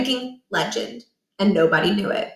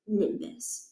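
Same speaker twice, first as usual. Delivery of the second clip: muttered; 11 dB quieter than usual. A narrator's reading. Son muttering as he turns his back on his father. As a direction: mutter it with your back turned.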